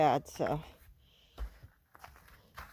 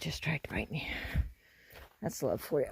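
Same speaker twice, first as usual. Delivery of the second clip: whispering; 5 semitones lower, 8 dB quieter than usual. Real, not acted. Whisper after bite.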